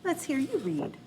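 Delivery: ordinary talk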